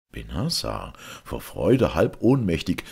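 ordinary delivery, nothing marked

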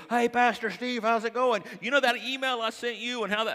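loud, high, gushing fan voice; overly familiar fan. Retold or imitated. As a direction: play the stranger who thinks he's your best friend. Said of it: "And they go".

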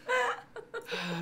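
suppressed laugh bursting out; stifled giggles. Real, not acted. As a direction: giggle held back through the nose. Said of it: "That one was funny".

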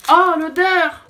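high, loud cry of disgust; disgusted exclamation. Real, not acted. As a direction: recoil at a strong smell. Smells the liqueur chocolate.

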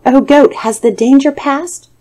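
high, loud anxious call. A narrator's reading. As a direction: shout from inside the stockade. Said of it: "the tiger called out".

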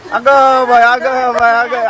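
loud startled oh my; surprised exclamation. Real, not acted. Vlogger seeing grandma drenched, loud surprised exclaim.

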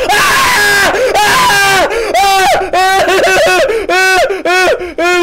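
high screams shrinking into short yelps; repeated screams. Real, not acted. Player cornered in the game, repeated panicked screams.

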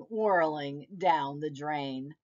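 ordinary delivery

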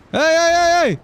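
loud high calls of hey; calling out. Real, not acted. Streamer yelling at the driver who jumped out, loud calls. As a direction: shout after the driver.

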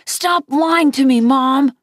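put-on child lashing out at Mom; narrated shriek. A narrator's reading. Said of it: "I shrieked".

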